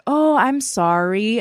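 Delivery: slow, drawn-out mock apology; slow fake apology. Thief turning round to the owner, slow mock sorry. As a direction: stretch it out like a caught thief. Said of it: "she slowly turned around and was like".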